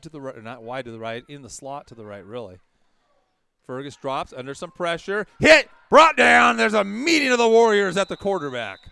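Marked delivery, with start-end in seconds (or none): 3.7-8.8 shouted, high sack call; excited play-by-play. Acted. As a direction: bark out the big hit.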